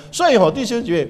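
normal talking voice

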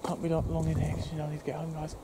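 normal talking voice

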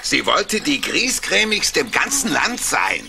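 usual speaking voice

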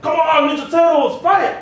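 high, loud playful yell; excited child shouting at the TV; kid's battle cry. Retold or imitated. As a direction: yell like a kid mid-fight. Child yelling while playing Ninja Turtles.

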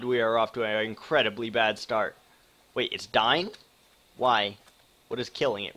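usual speaking voice

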